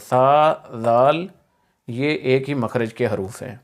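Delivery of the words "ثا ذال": ثا and ذال slow, tongue between teeth; slow, louder ثا ذال; tongue-between-teeth letters. Shown as a demonstration. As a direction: draw both out from one articulation point. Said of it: "وہی ثا کا مخرج ہے".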